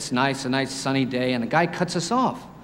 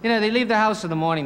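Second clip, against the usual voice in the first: ordinary delivery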